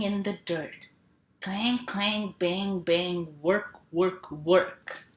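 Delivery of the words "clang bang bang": ordinary talk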